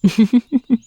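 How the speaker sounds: high, quick wicked giggle; teasing giggle. Acted. Witch joking about testing poisons, teasing high giggle. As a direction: giggle high at your own threat.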